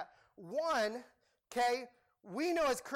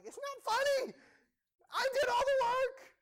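much higher, louder whiny protest; indignant complaint; 7 dB louder than usual. Retold or imitated. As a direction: squeal it like the wronged one who did everything. Retelling his own complaint.

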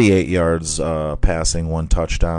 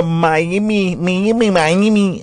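high sing-song Miami; 10 semitones higher, slower than usual. Pretend. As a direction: lilt the city name up high.

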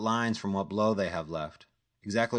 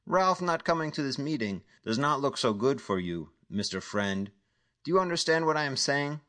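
higher, cold threatening voice; menacing caller; 6 semitones higher than usual. Acted. Dealer on the phone threatening Jack, cold menace. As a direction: lean on him like a dangerous dealer.

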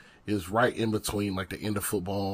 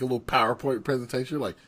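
ordinary talk

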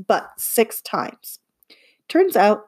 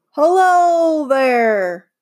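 higher, louder, slow drawn-out hail; calling out; 6 semitones higher, 5 dB louder, much slower than usual. Acted. A man greeting the kids from down the driveway, calling out. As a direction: call it across the yard.

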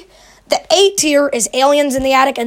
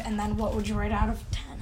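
whisper; 4 semitones lower, 13 dB quieter, faster than usual. Real, not acted. Asking rating, whispered.

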